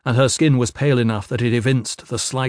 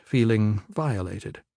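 plain reading voice

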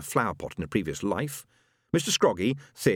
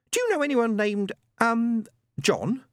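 medium's voice; 13 semitones higher than usual. Acted. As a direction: ham up the spirit message. Madame Tracy invents message.

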